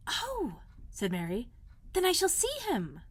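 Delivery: high, eager little voice; light up at the news; excited girl. A narrator's reading. Mary delighted she will meet Dickon, eager and high.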